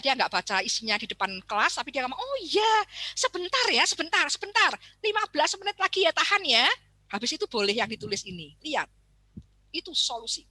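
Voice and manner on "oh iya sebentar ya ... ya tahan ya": high, louder bright teacher voice; cheerful teacher. Retold or imitated. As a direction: soothe the pupil brightly. Teacher answers child's note.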